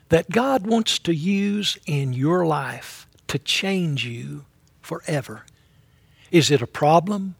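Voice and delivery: ordinary talk, nothing marked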